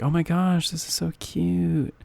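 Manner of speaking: a hint of a squealing commenter; gushing girl. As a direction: coo it like a gushing fan. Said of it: "some of the girls commented things like".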